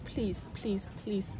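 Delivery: ordinary delivery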